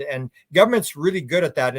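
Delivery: usual speaking voice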